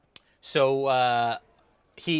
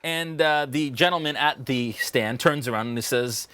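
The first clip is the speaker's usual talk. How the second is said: normal talking voice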